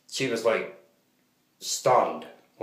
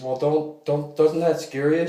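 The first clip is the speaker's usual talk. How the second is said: higher, louder, slow alarmed question; imitating the alarmed cashier; 7 semitones higher, 4 dB louder, slower than usual. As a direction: play the cashier, worried and pushing. Cashier asks if it scares.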